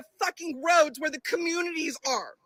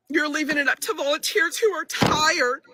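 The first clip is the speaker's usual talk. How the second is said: ordinary talk, nothing marked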